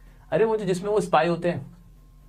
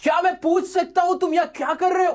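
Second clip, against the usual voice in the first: high put-on voice for the questioner; quoting a film scene in a different voice; movie quote; 9 semitones higher than usual. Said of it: "Sanjay Dutt की वो movie है ना".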